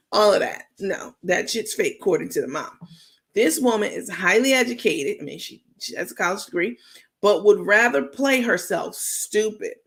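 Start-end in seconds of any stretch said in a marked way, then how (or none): none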